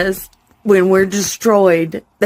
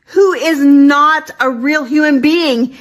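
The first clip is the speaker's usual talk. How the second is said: furious yell; 8 semitones higher than usual. Real, not acted. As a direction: let him have it. Furious insult.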